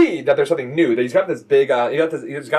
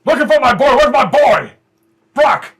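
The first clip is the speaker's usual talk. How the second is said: loud bellow across the shop; booming call; 10 semitones higher, 9 dB louder than usual. Acted. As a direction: call out for your boy. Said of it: "a deep booming voice".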